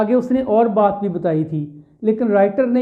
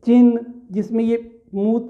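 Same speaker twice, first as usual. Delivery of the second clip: normal talking voice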